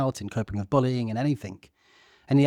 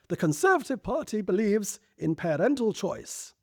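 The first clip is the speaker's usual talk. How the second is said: high, measured Thatcher voice, slowing at the end; Thatcher impression; 9 semitones higher than usual. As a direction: play Thatcher rallying a party conference. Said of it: "where she said".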